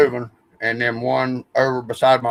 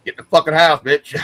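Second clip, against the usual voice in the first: a mock yell, higher and louder; mock stern yell; 5 semitones higher, 5 dB louder than usual. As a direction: bark it like a fed-up order, half laughing. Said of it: "says it in that type of voice".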